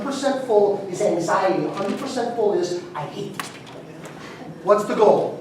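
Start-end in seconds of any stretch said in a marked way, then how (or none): 2.9-3.8 a hushed bitter aside, barely breathed; unvoiced mock whisper. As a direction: whisper it like a burned-out barber's secret thought. Burned-out barber's inner voice.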